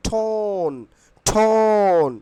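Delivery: long drawn-out টোন with aspirated T; model pronunciation. Shown as a demonstration. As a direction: stretch the vowel of টোন, second one loud. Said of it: "টোনের উচ্চারণ কি হবে?".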